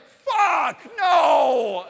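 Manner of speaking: loud high cry of despair; anguished shout. Retold or imitated. Dad seeing the mess on his son, loud despairing shout. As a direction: yell it like everything just fell apart. Reacting to poop in hair.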